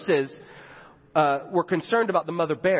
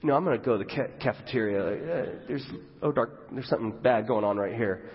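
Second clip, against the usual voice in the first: lightly voiced doctor, lower and hesitant; thirsty doctor on break; 5 semitones lower, 4 dB quieter than usual. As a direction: play a doctor awkwardly backing away, trailing softer. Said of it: "imagine the really thirsty doctor on breaks".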